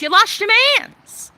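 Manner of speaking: high, loud mocking sing-song on lost; high taunting sing-song at the bitter ex; taunting singsong. Real, not acted. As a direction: rub it in her face with a high tease. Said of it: "repeated taunt".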